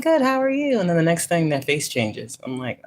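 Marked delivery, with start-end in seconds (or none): 0.0-0.8 much higher, louder, sing-song customer-service cheer; chirpy cheerful greeting. Retold or imitated. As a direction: answer brightly like nothing could be wrong. Retelling cheerful reply to customer.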